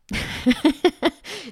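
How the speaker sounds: loud giggling laugh. Real, not acted. Amused by her own description, bursting out laughing.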